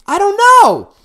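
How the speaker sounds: high, loud outburst; exasperated shout. Real, not acted. Venting to the host about his ex, exasperated shout. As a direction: throw your hands up and yell it. Repeats phrase, second blown up.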